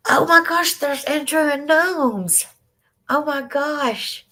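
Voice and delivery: high, drawn-out excited squeal of greeting; delighted surprise. Real, not acted. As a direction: gush with slow, high-pitched delight. Delighted at someone joining the chat.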